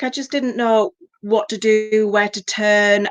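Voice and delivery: ordinary talk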